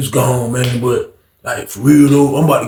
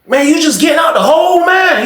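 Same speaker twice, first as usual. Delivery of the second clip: excited shout; 12 semitones higher than usual. Retold or imitated. Shocked at friend leaving solitary.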